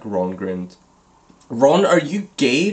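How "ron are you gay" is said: louder, startled Hermione exclamation; exclaiming Hermione. Acted. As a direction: blurt it out in mock shock. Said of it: "Hermione exclaimed".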